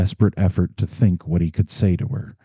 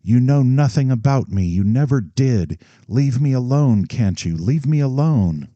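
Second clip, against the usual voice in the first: breaking into sobs; 7 semitones higher, 3 dB louder than usual. Acted. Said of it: "Her voice caught and broke into sobs".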